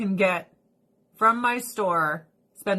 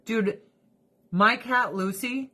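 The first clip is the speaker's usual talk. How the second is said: ordinary talk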